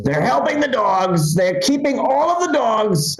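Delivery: high, drawn-out complaining impression; Donald Trump. Back on the news, as outraged Trump. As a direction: stretch each complaint in a put-on high voice. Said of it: "then Trump's back on the news".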